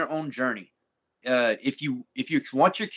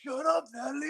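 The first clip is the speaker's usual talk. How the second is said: much higher put-on voice, quieter; mock squeaky quote; 14 semitones higher, 6 dB quieter than usual. Retelling the funny video to a friend, squeaky mock quote. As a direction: squeak the line as a joke. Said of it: "he goes".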